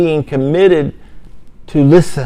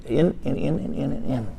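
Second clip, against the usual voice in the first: each in softer, trailing toward a whisper; fading repetition; 13 dB quieter than usual. Acted. Preacher describing the siege, fading to a hush. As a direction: let the words fade away as they push in. Enemy keeps pushing in.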